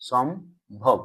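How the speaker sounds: সম্ভব with a clear gap, "shom... bhob"; syllable by syllable. Shown as a demonstration. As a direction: sound out সম্ভব in two parts. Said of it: "এভাবে তো উচ্চারণ করছি".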